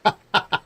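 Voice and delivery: loud, short burst of laughter; real laugh in brief bursts. Real, not acted. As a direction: let out a quick loud chuckle. Amused reaction while playing.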